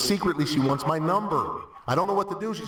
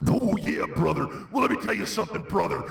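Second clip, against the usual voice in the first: Hulk Hogan impression; 6 semitones higher than usual. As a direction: shout it like a pro wrestling promo. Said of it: "That clip brought to you by".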